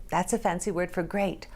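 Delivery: plain reading voice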